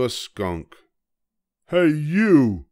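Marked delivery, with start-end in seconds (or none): 1.7-2.7 high, loud, grumpy little bug; a tiny bug spoiling for a fight, high and loud; grouchy ladybug. A narrator's reading. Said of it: "said the grouchy ladybug".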